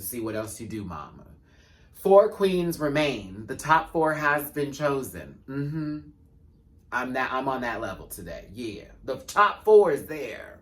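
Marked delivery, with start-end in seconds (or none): 1.9-5.4 slow, loud, high proclamation; mock grand announcement, drawn out; dramatic announcer. 9.0-10.6 slow, loud, high proclamation; mock grand announcement, drawn out; dramatic announcer.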